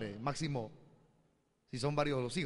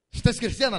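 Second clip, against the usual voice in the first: pointed question; 8 semitones higher, 10 dB louder than usual. Retold or imitated. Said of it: "le dije".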